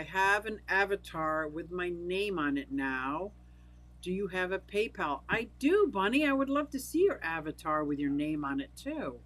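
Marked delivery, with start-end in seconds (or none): none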